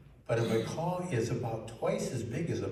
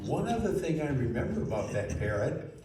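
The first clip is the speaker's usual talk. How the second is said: usual speaking voice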